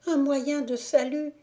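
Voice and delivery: high, loud urgent cry; fleeing girl pleading; panicked plea. Acted. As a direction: call for help in alarm. Zingora pleads again.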